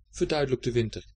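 usual speaking voice

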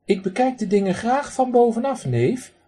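duck mimics his uncle, high and posh. Duck imitates his pompous uncle to the man, posh. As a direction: put on snooty airs. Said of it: "zegt hij dan heel bekakt".

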